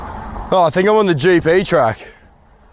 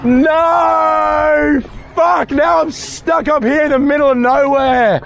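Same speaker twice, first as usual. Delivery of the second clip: high, drawn-out wailing shout; anguished shouting; 7 semitones higher, slower than usual. Real, not acted. Stuck uphill as the Koenigsegg passes, anguished shouting. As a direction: cry out in helpless dismay.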